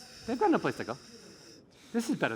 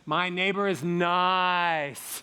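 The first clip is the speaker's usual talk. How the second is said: slow, held vowels; drawn-out steady tone; sustained voice exercise; much slower than usual. Shown as a demonstration. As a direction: intone it as a vocal drill. Coach demonstrates voice drill.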